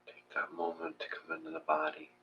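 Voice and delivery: soft, hushed meditation voice with long pauses; guided meditation. Acted. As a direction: ease them into the breath, quietly.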